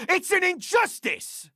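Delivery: indignant outcry. Acted. Protester decrying stolen evidence.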